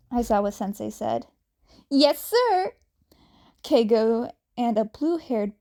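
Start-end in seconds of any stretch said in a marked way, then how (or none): 1.9-2.7 high, loud eager answer; eager reply. A narrator's reading. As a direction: snap to it with keen energy. Students answering the teacher eagerly.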